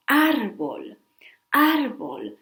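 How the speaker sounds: "árbol" with a loud first A, ÁR-bol; Spanish teacher modelling "árbol", stress up front; stress on accented vowel. Shown as a demonstration. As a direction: hit the A of "árbol" hard, then drop away on "bol". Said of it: "we stress that vowel".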